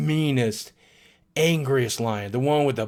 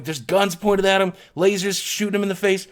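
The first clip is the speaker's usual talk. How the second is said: ordinary talk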